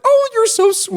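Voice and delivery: very high doting voice; sweet old lady voice. Retold or imitated. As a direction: gush like a grandma. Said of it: "they're like".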